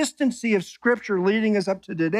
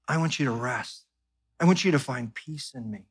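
tender God; 6 semitones lower, 5 dB quieter than usual. Pretend. God speaking intimately to us.

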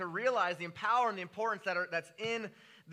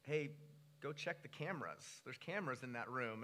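much softer, hushed inner voice; soft whisper; 6 semitones lower, 10 dB quieter than usual. Retold or imitated. God whispering to the preacher, soft hushed voice. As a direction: speak it like a quiet nudge in the mind. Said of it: "God whispering in my mind".

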